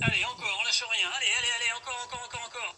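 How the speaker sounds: loud, high hype shouting; gym bro. Acted. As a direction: push them hard through the final reps. Coach cheering on the last reps.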